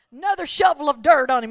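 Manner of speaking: ordinary talk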